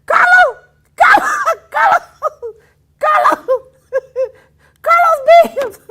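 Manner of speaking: laughing call out to candidate Carlos. Real, not acted. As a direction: shout his name across the room.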